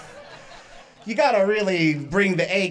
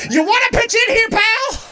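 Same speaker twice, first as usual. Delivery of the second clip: high, loud dad-style yell; shouting like dad; 13 semitones higher, 5 dB louder than usual. Retold or imitated. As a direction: holler it like an irritated father. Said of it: "I turned it into my dad".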